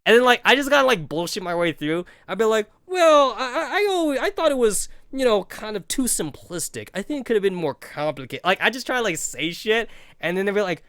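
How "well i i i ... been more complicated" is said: high, faltering mock-confident answer; nervous bluff. Bluffing through a book question, high nervous stammer. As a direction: stammer a fake smart answer, pitched up.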